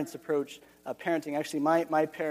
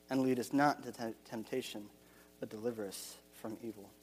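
lower, softer, reverent recital of the prayer; prayer recitation; 4 semitones lower, 5 dB quieter than usual. Acted. Pastor reciting the Lord's Prayer for the congregation, low and hushed.

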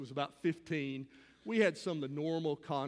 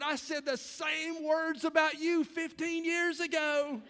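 amused retort; 9 semitones higher than usual. Retold or imitated.

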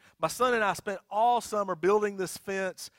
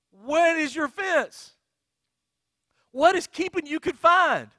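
loud, high, impassioned exhortation; full-force preaching; 8 semitones higher, 10 dB louder than usual. Real, not acted. Pastor urging church to cut the fence, impassioned preaching. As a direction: hammer each question home to the congregation.

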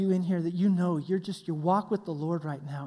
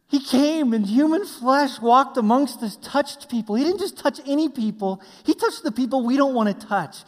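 louder, higher preaching voice; impassioned preaching; 6 semitones higher, 7 dB louder than usual. Acted. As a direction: preach it at full force, marveling. Preaches at full force.

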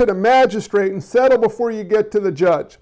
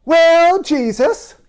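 put-on a half-formed comic British accent; mock British accent; 7 semitones higher, much slower than usual. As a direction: start slipping into a hammed-up British voice. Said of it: "y'all haven't heard the accents".

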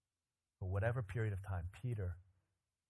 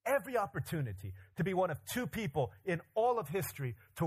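loud, much higher, driving preacher's voice; full-force preaching; 7 semitones higher, 5 dB louder than usual. Acted. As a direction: preach it out to the back row.

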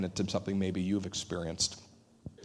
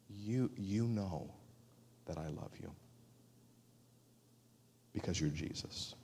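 humble confession; 10 dB quieter than usual. Acted. Peter's humble confession to Jesus.

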